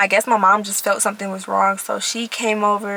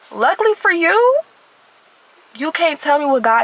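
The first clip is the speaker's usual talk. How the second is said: sassy retort; 7 semitones higher, 4 dB louder than usual. Real, not acted. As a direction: tease the doubter, drawing it out. Mocking someone who doubts her faith.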